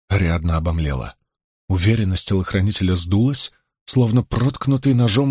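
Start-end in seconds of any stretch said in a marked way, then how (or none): none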